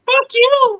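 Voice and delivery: high puppet snap; Elmo voice. Acted. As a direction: swear like a cheeky red puppet. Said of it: "you gotta do the voice now".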